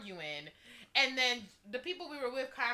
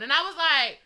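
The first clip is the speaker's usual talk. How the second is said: ordinary delivery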